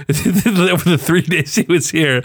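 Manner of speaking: ordinary talk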